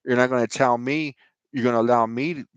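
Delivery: ordinary delivery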